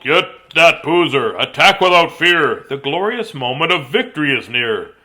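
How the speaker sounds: loud, drawn-out commanding yell; big rallying cry; yelled battle order. A narrator's reading. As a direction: yell the charge like a general. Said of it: "Then he yelled".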